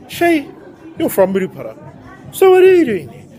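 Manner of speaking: high, questioning imagined viewer; mock viewer question. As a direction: voice a curious viewer.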